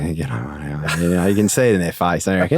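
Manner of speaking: put-on low, mumbled drunk bloke voice. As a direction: slur it like a day drinker at the pub. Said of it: "the blokes that are like".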